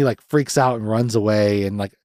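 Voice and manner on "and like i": ordinary talk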